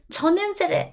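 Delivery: high-pitched start of 저는 세레 broken off; wrong attempt, cut off. Shown as a demonstration. Teacher demonstrating the wrong answer, high and cut off. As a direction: start the wrong form high, then stop. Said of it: "nope, that's boop, wrong".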